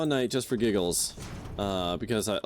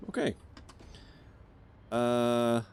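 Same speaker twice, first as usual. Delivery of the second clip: usual speaking voice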